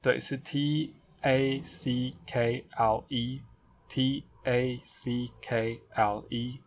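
slow letter-by-letter spelling. Shown as a demonstration. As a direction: spell each letter slowly. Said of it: "所以我就念给大家听吧".

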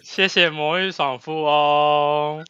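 dramatic slow thanks. Acted. Thanks donor in echoed drama.

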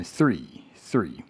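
"three" slow and soft, th blending into r; slow "three" with tongue between teeth, then r; TH plus R. Shown as a demonstration. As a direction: demonstrate "three" built from th and r. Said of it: "后面再加上R的声音".

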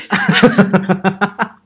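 a loud real laugh that climbs; laughing hard at herself; long rising laugh. Real, not acted. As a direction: laugh it out, helpless. Laughs long about her sobbing.

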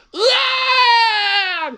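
high loud yelp; cry of falling. A narrator's reading. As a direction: yelp as he trips. Cat trips and falls. (摔倒时的尖叫)

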